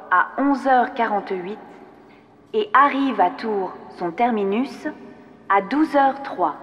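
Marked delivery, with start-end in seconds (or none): none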